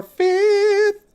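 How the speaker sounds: a comic high squeak; a sudden squeaky high note. Pretend. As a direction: jump up to a squeaky falsetto on fifth. Counting up to the fifth.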